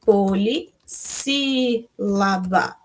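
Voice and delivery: very slow, every syllable separated. Shown as a demonstration. Teacher answering her own question, very slowly syllabified. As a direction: lay out each syllable for the class. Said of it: "Quatro são como que a gente chama?".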